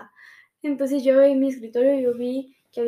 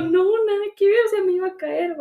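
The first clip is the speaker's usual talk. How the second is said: high, loud retold denial; protesting; 6 semitones higher, 5 dB louder than usual. Girlfriend explaining to her jealous boyfriend that she tripped, protesting. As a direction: push back in a raised, defensive pitch.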